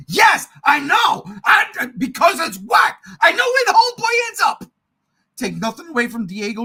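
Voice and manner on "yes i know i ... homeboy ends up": loud, high, worked-up agreement; exasperated shouting. Real, not acted. Host agreeing the show is whack, exasperated yelling. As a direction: shout it, climbing higher to the end. Ranting about whack Andor episodes.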